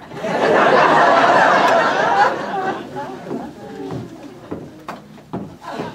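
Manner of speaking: loud, drawn-out mock wail; theatrical sobbing burst; hammed-up sob. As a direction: let out a long comic sob. Crying over dead bird.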